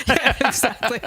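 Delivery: ordinary talk, nothing marked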